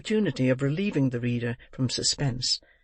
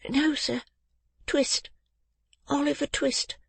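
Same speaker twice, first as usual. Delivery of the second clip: put-on high, small child's voice; timid little boy; 12 semitones higher, slower than usual. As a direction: correct him politely in a thin high voice. Boy corrects the old gentleman.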